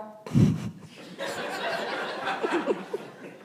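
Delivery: laughing. Real, not acted. Laughs at the odd word.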